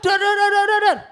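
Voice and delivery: shouted high dor dor gun noises; loud imitated gunshots; loud gunshot sounds. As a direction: blast out mock gunfire.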